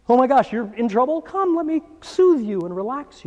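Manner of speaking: high, gentle, caring coo; soothing paramedic. Acted. Calming part of the body voiced as a paramedic. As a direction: comfort someone like a kindly medic. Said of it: "para, like paramedic".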